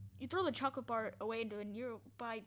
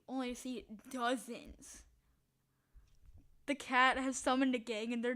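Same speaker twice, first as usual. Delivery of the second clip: normal talking voice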